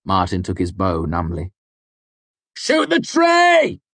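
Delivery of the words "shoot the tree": drawn-out yell, much higher and louder; shouting over thunder. A narrator's reading. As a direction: bellow it over the storm. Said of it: "I shouted over the thunder".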